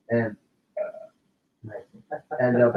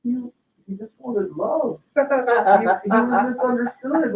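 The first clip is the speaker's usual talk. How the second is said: put-on higher, slow, earnest child's voice explaining the monster; imitating little daughter; 10 semitones higher, much slower than usual. Girl explaining to her dad after the movie, higher and slow. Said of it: "She goes".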